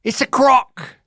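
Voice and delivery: hammed-up Crocodile Hunter impression; mock Australian accent. Son doing a mock Aussie croc line for dad. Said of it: "I can't do it".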